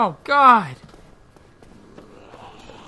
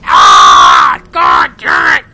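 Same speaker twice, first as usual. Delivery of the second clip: loud, low roar of frustration; raging yell; 11 dB louder, much slower than usual. Real, not acted. Gamer raging at the game, roaring curse. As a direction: bellow it at the screen.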